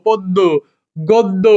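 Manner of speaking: পদ্য, গদ্য as "pod-do, god-do"; doubled d. Shown as a demonstration. Teacher joining the syllables, পদ্য and গদ্য with doubled d. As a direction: sound each d twice in পদ্য and গদ্য. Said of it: "দয়কারে দো".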